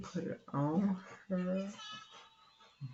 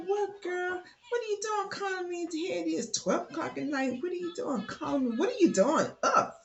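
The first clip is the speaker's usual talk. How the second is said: lightly voiced girlfriend, higher and surprised; 9 semitones higher than usual. Best friend Sally answering a midnight call, higher and surprised. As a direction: play the stunned best friend up high.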